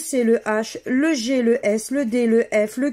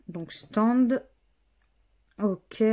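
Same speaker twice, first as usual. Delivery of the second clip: ordinary talk, nothing marked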